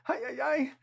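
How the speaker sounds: high, hammed-up "ay ay ay"; mock exasperation. Worker who can't understand his buddy, hammed-up exasperation. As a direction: throw up your hands in mock despair.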